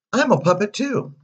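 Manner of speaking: put-on high, loud boyish boast; thrilled little puppet; excited puppet boy. A narrator's reading. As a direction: pipe up eagerly in a high voice.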